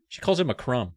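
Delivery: ordinary delivery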